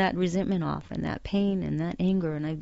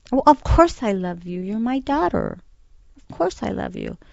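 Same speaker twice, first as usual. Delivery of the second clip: louder, higher start, easing off; imitating dad's emphatic reassurance; 4 semitones higher, 5 dB louder than usual. Daughter voicing dad answering "do you love me?", imitated emphatic reassurance. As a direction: blurt it as dad would, taken aback.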